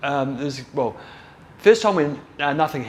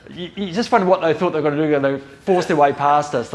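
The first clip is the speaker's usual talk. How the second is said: talking through a laugh, higher and louder; incredulous, raised voice breaking into a chuckle; amused disbelief; 5 semitones higher, 5 dB louder than usual. Real, not acted. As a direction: laugh at the absurdity of it as you speak. Amused by rushers' foolish plan.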